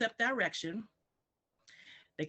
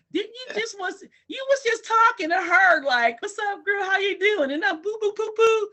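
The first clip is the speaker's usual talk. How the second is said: high, loud incredulous outburst; imitated baffled baby; 13 semitones higher, 10 dB louder than usual. Confused baby questions parent's babble, hammed-up bafflement. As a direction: squeal the protest in a hammed-up high voice. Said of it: "And they're like".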